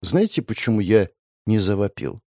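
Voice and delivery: usual speaking voice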